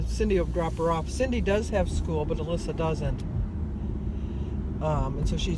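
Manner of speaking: ordinary delivery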